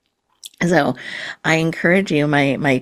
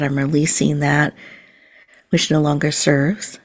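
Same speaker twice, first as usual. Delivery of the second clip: guided meditation. Acted. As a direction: gently guide the listener into calm and presence.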